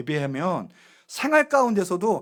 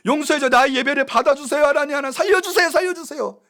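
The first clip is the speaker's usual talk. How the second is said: higher, louder cry for mercy; desperate pleading; 8 semitones higher, 7 dB louder than usual. Pretend. Cain as the pastor imagines him, begging God loudly for mercy. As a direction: beg God to save you, crying out. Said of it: "이래야 되는데".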